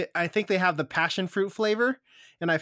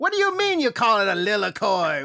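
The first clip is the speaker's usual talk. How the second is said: put-on Southern Texas accent; 3 semitones higher than usual. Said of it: "my, like, Southern Texas accent or Midwestern accent".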